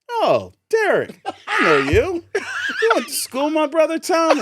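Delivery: high, loud, delighted cop calling out; friendly cop on megaphone. Retold or imitated. Officer on megaphone greets Derek, loud and high. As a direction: cop recognizing an old schoolmate, voice way up. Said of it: "the megaphone goes".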